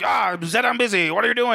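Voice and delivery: high, irritated outburst; annoyed yell. Retold or imitated. Doctor yelling at intruding landlady.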